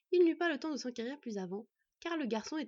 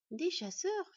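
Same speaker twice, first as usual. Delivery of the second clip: high rising mock astonishment; feigned surprise; 8 semitones higher, much slower than usual. A narrator's reading. Boy fakes astonishment to the villager, rising high. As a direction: play at being amazed. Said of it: "en prenant l'air étonné".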